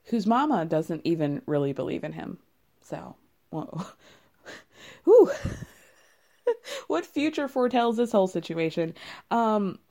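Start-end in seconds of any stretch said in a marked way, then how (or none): 5.0-5.3 laughing whew. Real, not acted. Reacting to a mama's boy.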